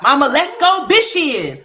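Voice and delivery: put-on high, loud little-boy voice saying "bishing" instead of "fishing"; loud, high childish "let's go bishing"; imitating son's mispronunciation. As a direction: say "bishing" with a B where the F should be, like a small child. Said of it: "He be like".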